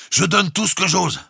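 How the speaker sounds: high, loud reply up the tube; shouting back. A narrator's reading. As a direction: shout it up to the bridge. Engineer shouts back through tube. (réponse criée)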